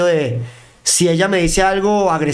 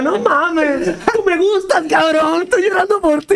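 hammed-up whiny plea, much higher, laughing through it; squeaky pretend heartbreak; mock crying plea; 13 semitones higher, slower than usual. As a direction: play it as a fake sobbing lover. Hams up his own pleading reply.

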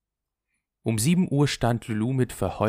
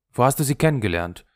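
fast, loud, higher jealous demand; angry questioning; 4 semitones higher, 8 dB louder, faster than usual. A narrator's reading. Henri interrogates Lulu about where she met them, angry. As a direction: fire it off as an accusation.

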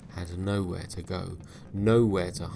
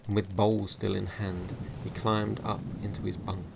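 ordinary delivery

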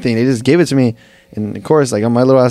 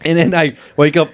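ordinary talk

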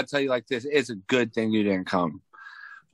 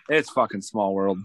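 ordinary delivery

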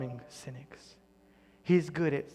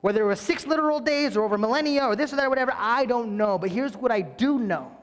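louder and higher, driving; full-force preaching; 7 semitones higher, 8 dB louder than usual. Real, not acted. Pastor urging his church, full-force preaching. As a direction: preach it hard to the crowd.

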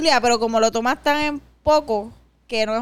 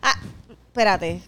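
ordinary talk

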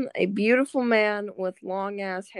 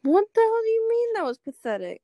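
indignant outburst; 11 semitones higher, 6 dB louder than usual. Real, not acted. Offended at being called pathetic.

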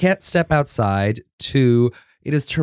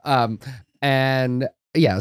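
ordinary talk

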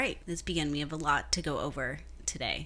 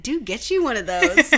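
laughing; 8 semitones higher, 11 dB louder than usual. Retold or imitated. Laughing advice to friend.

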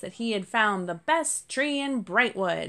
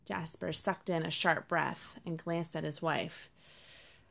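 ordinary delivery

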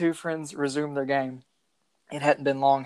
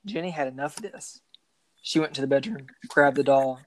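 ordinary delivery

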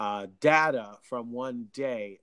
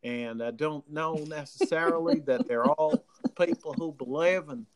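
imitated Southern country accent; demonstrating a drawling country accent; country twang; 3 semitones higher than usual. Shown as a demonstration. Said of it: "someone like this who's got this kind of twang".

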